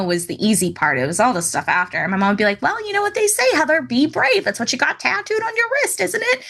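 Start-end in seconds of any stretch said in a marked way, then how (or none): 2.6-6.4 high, sing-song nagging mother imitation; put-on mom voice. Daughter mimicking mother's reminder, exaggerated mom voice. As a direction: pitch it up as a needling mom. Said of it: "She doesn't talk like that".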